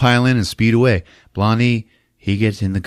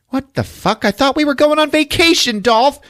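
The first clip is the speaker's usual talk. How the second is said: high, exasperated complaint; whiny acted protest; mock indignation. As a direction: imitate an upset girlfriend, pitched high. Acted quarrel, girlfriend complains.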